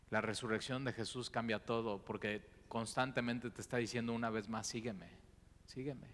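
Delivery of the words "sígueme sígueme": softly calling sígueme; a soft, loving invitation; Jesus calling his follower, softer and tender. Acted.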